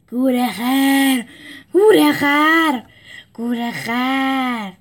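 slow, loud, drawn-out animal chant; caged animals roaring the word; chorus of beasts. A narrator's reading. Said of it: "صدای همهٔ زندانی ها بلند شد".

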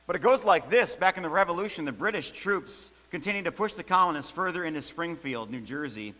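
ordinary talk

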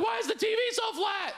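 very high-pitched cry of astonishment; mock bewildered yell. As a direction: yelp it like a coma patient baffled by the new world. Acts someone waking from coma.

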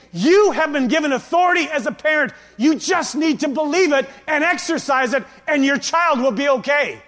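high, loud preacher's urging; emphatic exhortation. Acted. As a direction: drive it home to the parents, forcefully. Preacher urging parents imagined in store.

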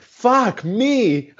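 like the penny just dropped; mock-stunned gasp of realization. Realization, retold.

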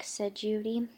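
usual speaking voice

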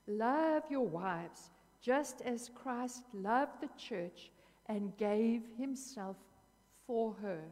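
slow, loud and deliberate, each word weighed; slow reading. Real, not acted. Preacher reading scripture to the congregation, slow and emphatic. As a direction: read it out very slowly and with weight. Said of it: "I'm gonna read it to you slowly".

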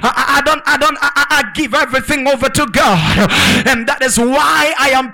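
fired-up preacher driving each phrase home; full-force preaching. Real, not acted. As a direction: declaim it to a congregation, forceful and sustained.

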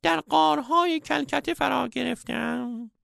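very high, piping wise-man voice; comic falsetto old master; squeaky old sage. A narrator's reading. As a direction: play the sage as a squeaky cartoon guru.

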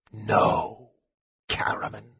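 soft, hushed whisper. A narrator's reading. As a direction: whisper it as a quiet restraint. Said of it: "Raistlin whispered".

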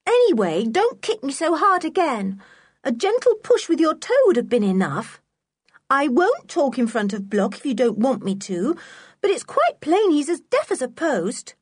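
put-on high-pitched, huffy child's grumbling; aggrieved and insistent; cross. A narrator's reading. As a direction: keep complaining crossly, voice well up. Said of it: "said Anne crossly".

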